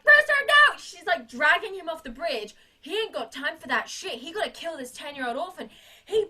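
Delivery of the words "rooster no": high, loud pleading shout; desperate scream. Retold or imitated. Miss Hannigan dragging Rooster off the bridge, high loud shout. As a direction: yell it after him.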